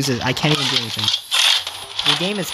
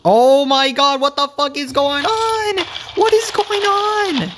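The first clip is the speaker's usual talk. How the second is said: shocked outburst; 13 semitones higher, slower than usual. Real, not acted. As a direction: shriek in disbelief. Freaking out at glitchy game.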